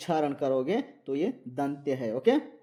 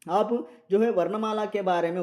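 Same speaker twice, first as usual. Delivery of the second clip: ordinary delivery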